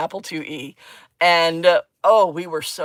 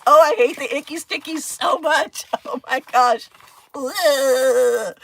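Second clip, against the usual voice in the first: grossed out; 8 semitones higher, 5 dB louder, slower than usual. Real, not acted. Disgust at sticky residue.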